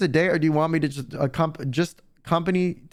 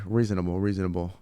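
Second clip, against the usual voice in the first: low, much softer approval; low approving murmur; 11 semitones lower, 5 dB quieter than usual. Real, not acted. Agreeing with chat, low soft approval. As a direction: nod it through quietly.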